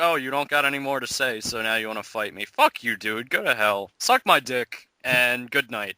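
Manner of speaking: low and quick, brushing him off. Retold or imitated. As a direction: rattle off the comeback low and fast. His own retort to tough guy.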